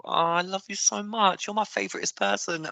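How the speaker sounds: mock adoring pet voice; fawning cat. Acting a cat begging for food.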